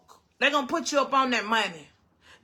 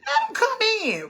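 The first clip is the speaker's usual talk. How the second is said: high, laughing No, let 'em come in; laughing retort; 8 semitones higher than usual. Retold or imitated. Telling the police to come in, high and laughing. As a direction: laugh it off while waving them in. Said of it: "I said".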